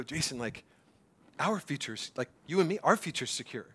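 soft, low, personal address; God's intimate reassurance. Acted. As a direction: say it gently, as God confiding in one person. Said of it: "No, he would go".

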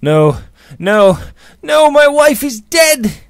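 high, loud cry, drawn out at the end; anguished shout. A narrator's reading. George insists his wife is dead, shouting in anguish. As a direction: build to a full cry of despair. George rejects all hope, grieving.

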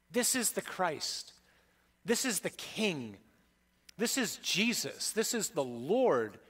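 slow litany with "is" punched each time; stress on is. Shown as a demonstration. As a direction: lean hard on "is". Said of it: "repeated litany of titles".

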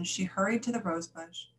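plain reading voice

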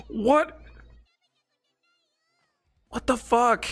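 very high, incredulous questioning; real shocked disbelief. Real, not acted. Viewer shocked by the show, high-pitched disbelief. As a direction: ask it high, totally baffled. Shocked reaction after laughing.

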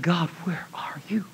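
unvoiced whisper, soft and desperate; silent prayer. A terrified driver praying to God, whispered. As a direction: whisper it as a private plea.

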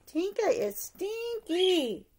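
plain reading voice

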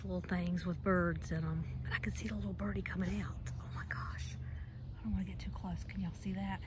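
soft, half-whispered aside; hushed. Real, not acted. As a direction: keep it down near a shy little bird. Trying not to startle a bird.

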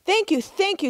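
high eager coyote thanks; trickster tale voices. Acted. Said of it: "said Coyote".

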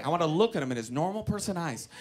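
ordinary delivery

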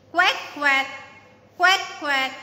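loud quacking; duck quack. Shown as a demonstration. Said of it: "A duck says".

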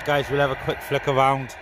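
ordinary talk, nothing marked

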